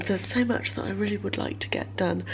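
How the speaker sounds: unvoiced whispered confession. Real, not acted. Tired vlogger confiding to viewers, whispering. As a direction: let it drop to a breathy whisper.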